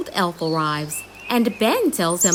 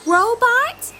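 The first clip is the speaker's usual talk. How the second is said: calling out; 4 dB louder than usual. Acted. Ben calling the robot.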